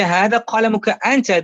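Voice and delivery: firm and fast, stressing أنت at the end; insistent emphasis. Acted. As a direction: insist it's his pen, not anyone else's. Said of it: "let's say you're arguing with him".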